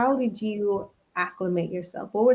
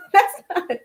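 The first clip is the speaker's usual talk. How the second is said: higher, laughing denial; laughing protest; 9 semitones higher, 5 dB louder than usual. Retold or imitated. Denying to Spaniards she won't hang out, laughing protest. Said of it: "And I'm like".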